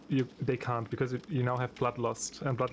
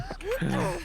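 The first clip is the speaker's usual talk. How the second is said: sharp gasp. Real, not acted. Totem on her, surprise.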